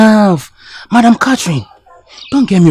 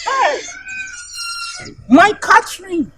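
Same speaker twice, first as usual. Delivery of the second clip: excited call; 5 dB quieter, slower than usual. Acted. Lover calls out to Catherine.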